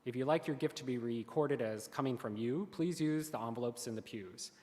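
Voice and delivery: ordinary talk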